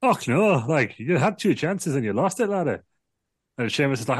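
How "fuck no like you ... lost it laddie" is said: high, slow, hammed-up Irish retort; mock Irish. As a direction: jab back in a silly high Irish voice. Sheamus voiced in retold promo.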